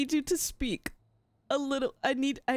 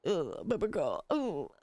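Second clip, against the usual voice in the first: put-on lower, crooning ooh baby girl; low mock croon, hamming up a smooth talker; mock TikTok fuckboy; 6 semitones lower than usual. Streamer parodying the character's voice, low hammed-up croon. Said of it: "exactly what it sounded like".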